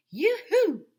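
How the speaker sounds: loud, high two-note yoo-hoo; high, hooting search call. A narrator's reading. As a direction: trill it out to someone hiding. Teacher calls for missing woman.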